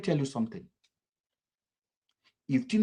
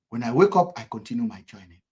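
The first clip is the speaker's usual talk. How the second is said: usual speaking voice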